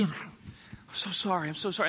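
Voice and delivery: soft, groggy murmured apology; acting a sheepish, half-awake disciple; sleepy apology. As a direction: apologize quietly, just roused from sleep. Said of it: "they're sleepy".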